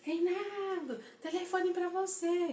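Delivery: high, drawn-out shout for Reinaldo; calling out. Retold or imitated. Mother calls her son to the phone, high drawn-out shout. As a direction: call his name across the house. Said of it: "gritando, gritando".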